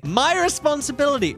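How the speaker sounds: ordinary talk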